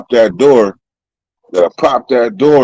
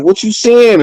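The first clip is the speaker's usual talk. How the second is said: mock challenge; 8 semitones higher than usual.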